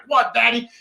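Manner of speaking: a hint of a little girl asking; little daughter playing along with dad's joke, lightly childlike; daughter's voice. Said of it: "And she's like".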